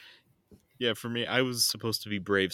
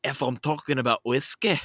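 loud, higher-pitched Scottish accent; 6 semitones higher, 6 dB louder than usual. Shown as a demonstration. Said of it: "I can only get into a Scottish accent".

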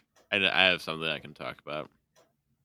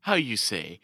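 slow, halting mock-posh British; mock British accent; 7 semitones higher, slower than usual. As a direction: put on a hammed-up British accent. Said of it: "we haven't done British accents on here".

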